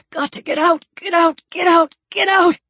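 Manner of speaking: rising, urgent panic in the head; panicked. Acted. A drowning man tells himself to get out in panic. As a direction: push the words out in rising panic.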